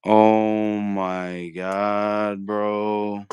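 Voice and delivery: ordinary delivery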